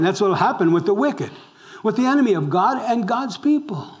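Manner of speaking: ordinary delivery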